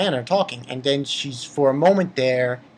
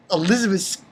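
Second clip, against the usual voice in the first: usual speaking voice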